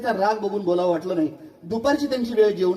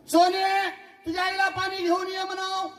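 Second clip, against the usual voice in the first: high calling father; high repeated call to the daughter; 8 semitones higher than usual. Retold or imitated. Father calls again.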